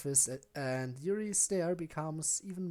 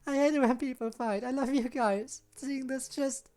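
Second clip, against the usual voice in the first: higher, pleading, upset; pleading girl; 10 semitones higher than usual. Acted. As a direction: beg the friends to stop. Sayori pleading.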